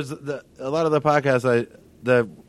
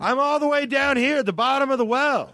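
high, plaintive call for help; fallen-in-a-well voice; 11 semitones higher than usual. Pretend. Trapped at the bottom pleading for rope, high calling voice. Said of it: "I've fallen in a well voice".

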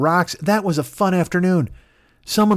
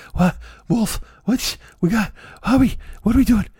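higher, broken-up bewildered questions; acted groggy confusion, raised pitch; confused family; 7 semitones higher than usual. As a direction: stumble out half-awake and baffled.

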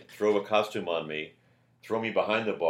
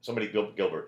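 ordinary talk, nothing marked

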